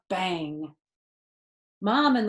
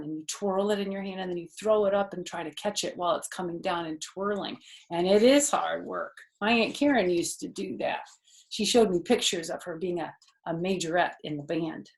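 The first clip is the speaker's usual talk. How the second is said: usual speaking voice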